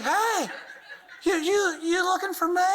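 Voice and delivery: squeaky, cheeky little creature popping up; turtle head voice. Acted. The toothpaste blob taunting the person squeezing, high and cheeky.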